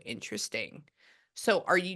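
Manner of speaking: normal talking voice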